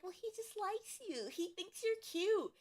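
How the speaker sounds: high, lightly put-on mom; mom's voice. Mom dismissing the bully complaint, high put-on voice. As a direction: brush it off sweetly as mom.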